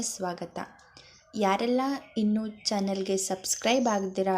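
friendly host's welcome and subscribe appeal; a host greeting viewers before the chapter; channel host intro. Acted. Host welcomes listeners and asks them to subscribe, in a friendly announcer voice.

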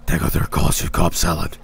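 low, whispery dark elf menace; deep, hushed, cold dark elf whisper. Acted. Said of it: "that dark elf voice".

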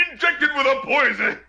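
high-pitched mock shout; imitated shouted tag. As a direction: imitate a jarring producer tag. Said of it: "It's just, like, shouted".

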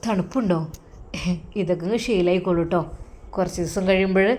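low, hushed husband teasing his wife; lightly voiced husband, soft and low; husband's voice.

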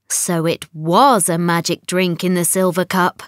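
ordinary delivery

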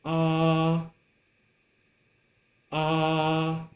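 level high steady "ah", held flat; first tone demo. Shown as a demonstration. Teacher showing the first tone to students, level steady "ah". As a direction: sing out "ah" on one even pitch. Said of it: "And for the second tone".

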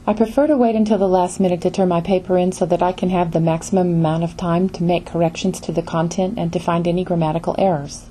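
fast run-on reading with no phrase breaks; rushed, no pauses. Shown as a demonstration. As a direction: race through it in one breath, no grouping. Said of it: "nobody would read a sentence in the following way".

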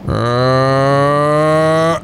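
long held "uhhh", pitch creeping up; drawn-out uh. Real, not acted. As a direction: hold one rising groan through the throw. Long noise during a bowling throw.

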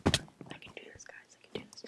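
very soft whispered pep talk; whispering. Real, not acted. Player psyching self up to do this, whispering. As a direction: whisper encouragement to yourself. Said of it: "I don't know why I'm whispering".

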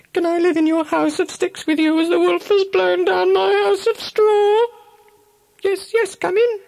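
very high, tiny piglet voice; a frightened little pig begging to come in; squeaky little pig. Acted. First pig asking the second pig for shelter, high squeaky piglet voice.

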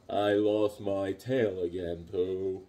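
low, slow, gloomy donkey voice; low slow mopey donkey lament; Eeyore impression. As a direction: moan it out like a sad donkey. Eeyore-like voice after Pooh.

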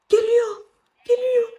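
alarmed warning. Real, not acted. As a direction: shout the warning in fright. Creature approaching. (korkuyla yüksek sesle uyarı)

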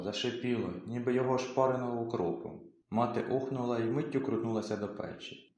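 ordinary delivery, nothing marked

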